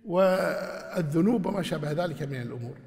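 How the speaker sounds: usual speaking voice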